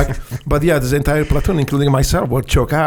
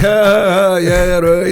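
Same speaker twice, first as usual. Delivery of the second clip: high, loud scoffing laugh; mocking laugh; 4 semitones higher, 4 dB louder, slower than usual. Recruits scoffing at the new officer, laughing. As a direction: laugh it off in disbelief. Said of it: "So we were just laughing".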